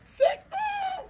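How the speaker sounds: very high, piercing shout of wonder; amazed shriek. Retold or imitated. Tourist spotting the pink statue, shrieking in amazement. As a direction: shriek at the sight like a stunned tourist.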